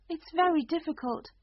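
put-on a puzzled little girl; young girl voice. A narrator's reading. Alice voice.

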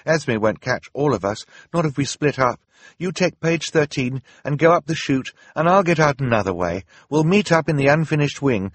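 calm her siblings with a plan; determined Violet. Acted. Violet voice held.

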